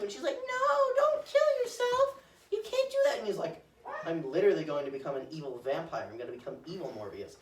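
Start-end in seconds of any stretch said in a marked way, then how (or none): 0.4-3.2 very high, slow mock-sobbing plea; mock crying plea. Girlfriend begging Morbius. 4.1-7.4 lower, fast deadpan; mock grim answer. Morbius replying to girlfriend.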